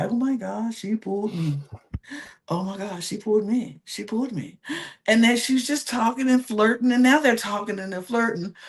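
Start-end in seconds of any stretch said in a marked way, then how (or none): 0.1-4.6 soft, dreamy, breathless gushing; swooning over being picked; mock lovestruck Nick. Lovestruck Nick gushing softly, hushed and dreamy.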